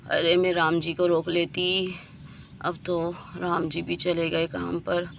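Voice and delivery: soft, low, weak and aching; barely able to get the words out; in pain. Acted. Kajal, too weak to get up, moaning quietly to herself.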